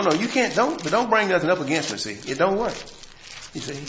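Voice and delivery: usual speaking voice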